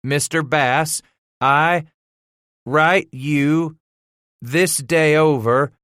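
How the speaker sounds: halting, faltering reading. A narrator's reading. Boy struggling to read a schoolmaster's letter to father, halting. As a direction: sound out each word, stammering on hard ones. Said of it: "faltering, halting at nearly every word".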